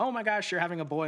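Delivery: high, gushing excitement; mother-in-law spoiling the surprise, gushing and high; excited mother-in-law. Retold or imitated.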